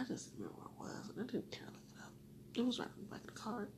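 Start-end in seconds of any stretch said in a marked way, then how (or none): none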